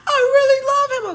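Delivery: high wailing cry, imitating the friend; mock heartbroken wail; mock sobbing. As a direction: sob it out in a hammed-up high wail. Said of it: "she's crying her eyes out".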